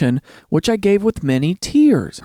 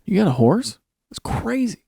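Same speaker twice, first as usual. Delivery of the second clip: mock astonishment at owning a horse; can't believe it, rising on got; amazed; 5 semitones higher, 3 dB quieter than usual. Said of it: "it's like".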